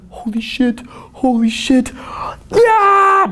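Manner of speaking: can't believe it worked, bursting out; giddy disbelief. Retold or imitated. Newbie after approaching a gorgeous girl, high giddy whoop.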